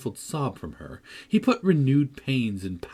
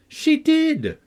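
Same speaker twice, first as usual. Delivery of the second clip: high loud cry; 7 dB louder than usual. A narrator's reading. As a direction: shout it high in protest. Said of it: "cried my young lady".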